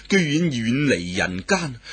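ordinary delivery, nothing marked

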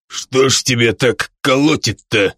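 put-on puzzled drunken grunt; a drunk wondering at her shaking; surprised drunk. A narrator's reading. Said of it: "удивлённо хмыкнул Деми". (удивлённо, пьяным голосом)

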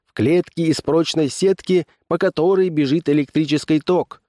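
high-pitched barker's patter; fairground barker. A narrator's reading. Barker selling his show to the crowd, showman's patter.

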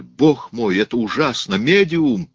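loud cry climbing to a high peak; horrified shouting. A narrator's reading. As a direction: cry out in mounting horror. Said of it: "кричал Рауль". (крик ужаса, всё выше и громче)

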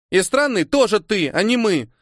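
put-on high-pitched, indignant girl; exclaiming little girl. A narrator's reading. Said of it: "воскликнула девочка".

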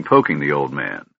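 plain reading voice

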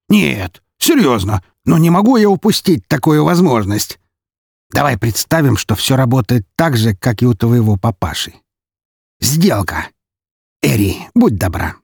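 a touch of eager showman, starting high; lightly voiced gleeful count. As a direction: talk yourself into the deal, mildly theatrical. (слегка театральный, азартный голос графа)

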